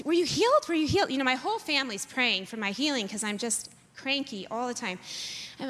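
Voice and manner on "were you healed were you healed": higher and louder, eager questions; eager stepdad. Retold or imitated. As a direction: press him eagerly for news. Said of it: "he's like".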